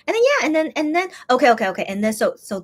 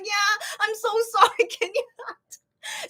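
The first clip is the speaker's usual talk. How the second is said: high retold apology breaking into laughter; laughing apology; 12 semitones higher, slower than usual. Apologizing to cleaning lady over a spider, laughing retold plea. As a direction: squeak it out through giggles.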